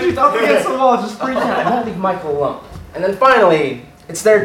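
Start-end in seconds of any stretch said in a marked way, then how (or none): none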